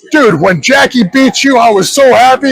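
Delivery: high-pitched, excited mock gloating; worked-up fan ribbing. Wrestling fan needling the villain on a plane, excited and high.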